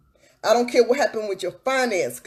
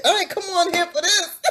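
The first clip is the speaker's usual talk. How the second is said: higher, laughing, can't believe it; laughing disbelief; 7 semitones higher than usual. Real, not acted. Laughing to viewers, amazed at her own preaching. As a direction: break into laughter on the words.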